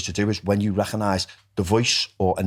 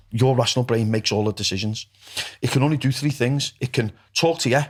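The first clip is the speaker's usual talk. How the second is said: usual speaking voice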